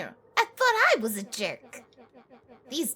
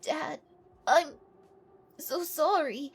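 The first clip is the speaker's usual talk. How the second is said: grieving son; 6 dB quieter, slower than usual. Acted. Luca grieving at father's grave.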